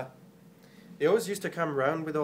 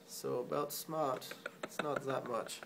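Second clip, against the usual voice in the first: German accent; 4 semitones lower, 7 dB quieter than usual. Shown as a demonstration. Comic undercutting the claim that Germans are smart, soft German accent. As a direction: let the German accent drop quietly. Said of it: "speak with a German accent".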